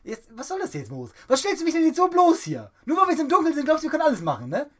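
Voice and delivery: high, loud mock indignant shout; fake-furious telling him off; mock outrage. Host fakes outrage at co-host for exposing him, shouting high.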